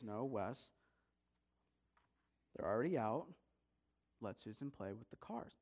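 low, soft parental no; calm refusal. Acted. Mom telling Wes to share his cars, calm refusal. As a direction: keep it quiet and even, not budging.

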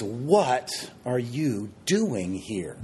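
WHAT hit first, then YOU and DOING set apart, drawn out very slowly; stress on each word. Shown as a demonstration. Preacher trying a phrase with varied emphasis, slow and word by word. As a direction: weigh the words one by one, pausing after WHAT. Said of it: "I like to emphasize different words".

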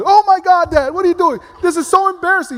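put-on the boy shrieks as if the book burns his lap; mortified son. Son yelps at dad on the plane, mortified squeal. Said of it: "it's like I put a, like, hot coals on his".

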